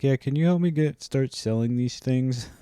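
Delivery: mock the buddy's casual request; imitating friend. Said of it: "And so he's like".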